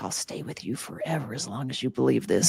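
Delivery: low creepy whisper of a devil; a lightly voiced, creepy little devil. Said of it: "I always give that little devil a voice".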